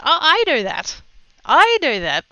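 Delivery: loud, falling cry on I; loud insistent outburst; emphatic exclamation. Real, not acted. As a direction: blurt it out at full voice. Exclaiming at the chat.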